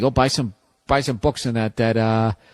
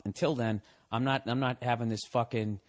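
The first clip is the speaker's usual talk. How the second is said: usual speaking voice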